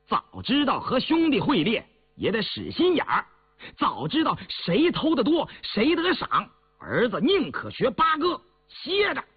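high-pitched stubborn back talk; defiant retort. A narrator's reading. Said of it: "梗着脖子顶了回来". (梗着脖子高声顶嘴)

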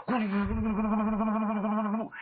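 long rolled-tongue drum rumble; imitated drum roll. A narrator's reading. Storyteller imitates drums cheering the archer, rolling trill. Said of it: "两旁边擂鼓呐喊".

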